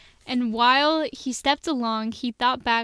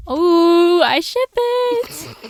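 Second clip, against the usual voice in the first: very high, drawn-out teasing squeal; high-pitched fangirl outburst; gushing squeal; 6 semitones higher, 8 dB louder, much slower than usual. Real, not acted. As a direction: coo it high and loud over a couple. Teasing squeal over two characters.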